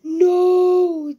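loud, high drawn-out no; distressed yell. Real, not acted. Gamer reacting to a creeper blast, distressed yell. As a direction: cry out in protest. Creeper just blew up the house.

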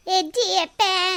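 slow, high, babyish mimicry of a child; mocking child talk. Mother mocks her crying daughter's baby talk. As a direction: drawl the baby words out high. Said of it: "ಅನ್ನು".

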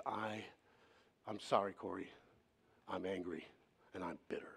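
low, hushed retold confession; hurt confession. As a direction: quote a hurt man haltingly, sinking toward a whisper. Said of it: "He was deeply hurt by the church".

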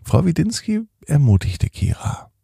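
usual speaking voice

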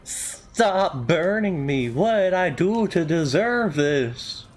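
high, slow, stretched-out complaint; pained wail. Pretend. Player being burned in game, mock pained wail.

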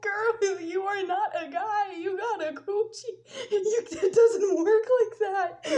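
high, loud talk bursting with laughter; laughing scolding. Real, not acted. Storyteller telling herself off about anatomy, laughing hard. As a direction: scold yourself while cracking up.

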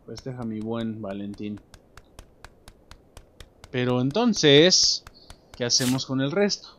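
ordinary delivery

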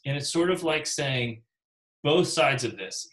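normal talking voice

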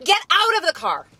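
loud, high, impatient order; exasperated shout. Acted. Mother hurrying a child to school, exasperated shout.

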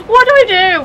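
hammed-up panic running back and forth; mock panic. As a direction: squeal it while running in circles. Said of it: "I'd run back and forth like".

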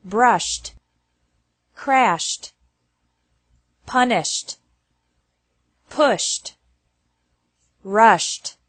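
"brushed" said alone, ending in SH-T. Shown as a demonstration. Teacher drilling verbs ending in SH, -ed pronounced as T. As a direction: say "pushed" as one syllable with a final T. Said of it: "E D is pronounced like T".

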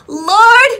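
very loud, high, overwhelmed cry; awestruck outcry. Retold or imitated. Mother addressing God after the miracle, awestruck outcry. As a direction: shout it out in amazement. Overwhelmed by daughter's chosen verse.

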